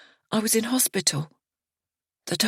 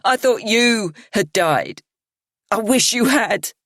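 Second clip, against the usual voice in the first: bitter shout; 5 semitones higher, 4 dB louder than usual. A narrator's reading. Miriana to narrator, a bitter shout. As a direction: hurl it at her. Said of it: "she lifts her head and shouts".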